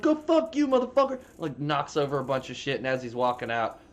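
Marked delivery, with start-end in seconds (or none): 0.0-1.2 very high, loud put-on yell; mock furious curse. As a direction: curse him out on the way out. Customer curses leaving.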